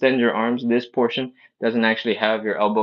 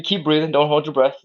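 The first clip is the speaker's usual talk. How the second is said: usual speaking voice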